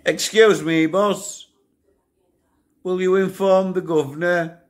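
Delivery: high, loud call through the door; mock shout. Inmate shouting to the guard before lock-up, high and loud. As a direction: sing out to the screw, polite but loud. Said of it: "I used to shout".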